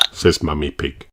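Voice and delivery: ordinary delivery, nothing marked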